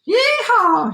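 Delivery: very high, loud yeehaw whoop; cowboy shout. A narrator's reading. Gingerbread cowboy escapes the rancher, high whooping shout. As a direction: let out a high rodeo whoop. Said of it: "he shouted".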